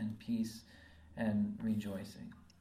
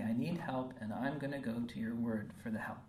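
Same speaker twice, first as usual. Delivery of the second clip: usual speaking voice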